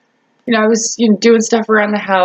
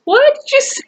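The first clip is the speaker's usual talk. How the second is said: high-pitched disbelieving question; mock shocked what did you say; squeaky stunned retort; incredulous high squeak; faster than usual. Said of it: "He goes".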